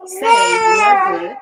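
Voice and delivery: high loud cry. Acted. Parent cries out line loudly.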